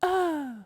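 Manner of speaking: a high, thin, effortful uh; imitated uh. Imitating the friend's good-times uh. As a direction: squeeze the sound out like it costs everything. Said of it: "And then he'll say".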